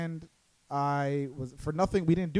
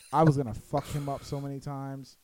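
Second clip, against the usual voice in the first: usual speaking voice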